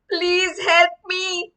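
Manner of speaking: loud, high, drawn-out cry for help; urgent plea. Acted. William begging the baby for help, loud plea. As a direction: plead loudly for rescue.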